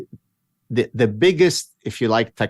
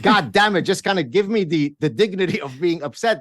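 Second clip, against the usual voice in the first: mock irate; 9 semitones higher than usual. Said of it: "kind of irate at her".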